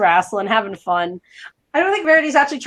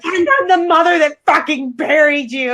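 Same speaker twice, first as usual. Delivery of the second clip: mock scream; 5 semitones higher than usual. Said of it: "screaming, like".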